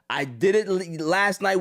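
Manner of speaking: ordinary talk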